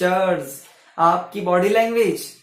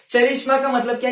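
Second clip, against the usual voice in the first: ordinary delivery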